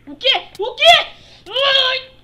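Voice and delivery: high, loud startled shrieks; shocked screaming. Acted. Sudden attack.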